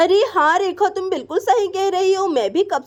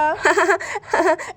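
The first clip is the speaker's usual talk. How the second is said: हल्की हँसी. Acted. Sister-in-law laughs at her joke.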